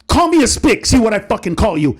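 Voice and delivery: high defiant jab back at the heckler; defiant punchline. Retold or imitated. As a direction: land the punchline hard and high.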